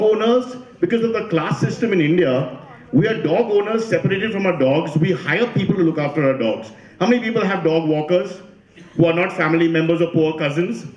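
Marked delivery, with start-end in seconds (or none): none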